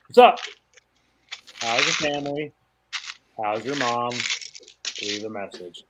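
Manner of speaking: lightly voiced friend's prank voicemail; friend's fake answer. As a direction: fake a live answer then drop to a message. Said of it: "his voicemail was like".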